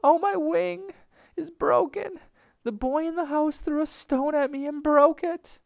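very high, loud bird shriek; crying out. A narrator's reading. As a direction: wail as an injured bird. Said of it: "cried out".

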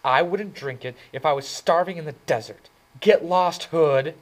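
mad. A narrator's reading. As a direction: tell him off, furious. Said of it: "She was mad by then".

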